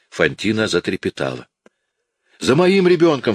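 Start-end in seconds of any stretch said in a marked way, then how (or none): 2.4-3.3 much higher, panicked outburst; frantic mother's cry; anguished cry. A narrator's reading. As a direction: cry out as she realises the child is missing. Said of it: "вскричала она".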